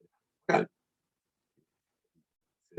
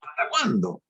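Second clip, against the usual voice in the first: "¿Hasta cuándo?" pitched very high; question intonation; 12 semitones higher than usual. Shown as a demonstration. Teacher showing another intonation, high-pitched questioning. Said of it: "Otra entonación".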